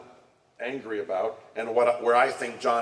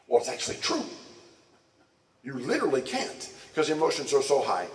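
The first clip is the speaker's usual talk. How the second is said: ordinary talk, nothing marked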